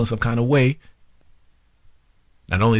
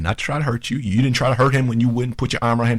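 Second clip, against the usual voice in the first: ordinary talk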